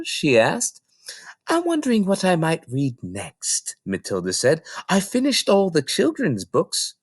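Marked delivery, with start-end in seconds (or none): 1.5-3.8 put-on little girl voice. Matilda answers librarian. 4.9-6.9 put-on little girl voice. Matilda tells librarian.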